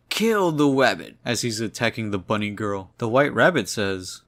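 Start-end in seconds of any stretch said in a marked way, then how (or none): 0.1-1.1 louder, higher cartoon yell, hammed-up; mock scream. Villain attacking the bunny girl, hammed-up louder cartoon scream. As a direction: bellow it like a cartoon hunter chasing a rabbit. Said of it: "he's screaming".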